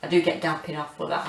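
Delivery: ordinary delivery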